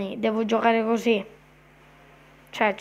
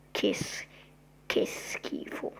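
whisper; 13 semitones lower, 8 dB quieter, slower than usual. Real, not acted. Disgusted whisper about the granny.

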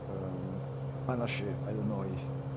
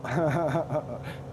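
laugh; 6 semitones higher, 6 dB louder than usual. Real, not acted. Laughing at Lillo's answer.

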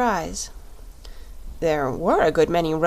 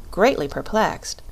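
ordinary delivery, nothing marked

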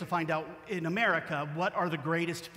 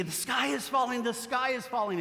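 lightly voiced goose cry, higher; panicked goose; 6 semitones higher than usual. Goosey Lucy shouts the warning to the crowd, mock panic. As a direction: flap and cry the alarm. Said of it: "But Goosey Lucy".

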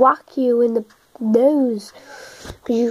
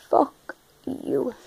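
whispered curse; whispering; 8 dB quieter than usual. Real, not acted. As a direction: hiss it under your breath. Cursing leaves quietly.

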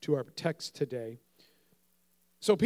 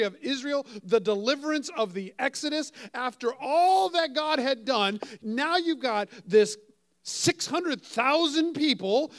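higher, louder preaching, slowed on key phrases; full-force preaching; 8 semitones higher, 7 dB louder than usual. Acted. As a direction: drive the point home to the congregation.

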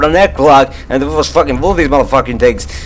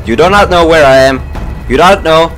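frightened pleading; 6 semitones higher, 4 dB louder than usual. Real, not acted. Hiding from hunters, pleading.

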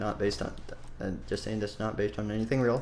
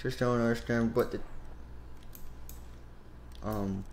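ordinary talk, nothing marked

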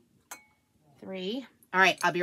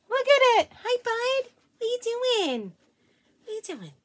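pet-talk voice; 12 semitones higher, 6 dB louder than usual. Real, not acted. Mom greeting her dogs in high baby talk.

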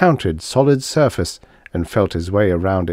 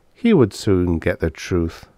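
ordinary delivery

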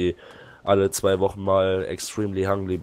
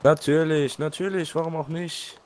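sarcastic; 9 semitones higher, slower than usual. Real, not acted. Game glitch annoys him.

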